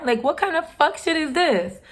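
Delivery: outraged disbelief. Real, not acted. Venting to viewers about the stolen car, high outraged disbelief. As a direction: voice climbing with indignation.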